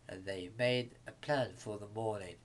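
ordinary delivery, nothing marked

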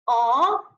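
long 哦 with a rising tone; second tone. Shown as a demonstration. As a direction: lift 哦 upward. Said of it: "连贯的说一下".

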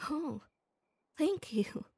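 ordinary talk, nothing marked

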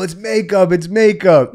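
high, loud insisting, imitating the bloody friend; mock insistent. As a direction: sell the lie to everyone, loudly. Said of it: "He's telling people".

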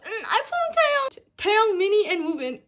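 high, loud delighted squeal; excited squeal. Real, not acted. Fan squealing over pulling [name], high and loud. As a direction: squeal over the cards you pulled. Excited card pull reveal.

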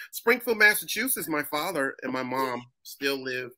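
proper-speaking caller. Retold or imitated. Acted proper answer on the phone.

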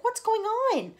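put-on frightened boy's cry; alarmed cry. A narrator's reading. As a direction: cry out, leaping up. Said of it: "cried James".

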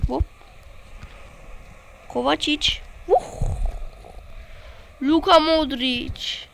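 ordinary talk, nothing marked

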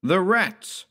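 high, startled exclamation; sudden cry. A narrator's reading. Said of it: "we suddenly cried out together".

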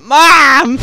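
high, loud fake call for help; hammed-up cry for mom; mock call for mom. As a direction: yell for mom like a hurt kid. Mock calling out after getting hurt.

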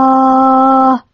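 a long open crowd cheer; crowd cheer sound. Shown as a demonstration. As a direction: imitate the roar of a cheering crowd. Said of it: "like that".

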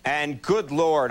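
ordinary talk

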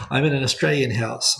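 usual speaking voice

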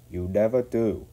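mildly stern reproof. A narrator's reading. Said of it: "said a stern voice".

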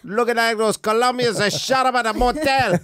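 high, lightly put-on gangster voice; mock Scarface quote. Quoting Scarface to tease a friend, high mock voice. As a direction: quote the movie with a mock swagger.

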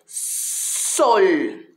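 Sol with the s hissed through clenched teeth. Shown as a demonstration. Teacher modelling the s of Sol with clenched teeth. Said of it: "apretando los dientes".

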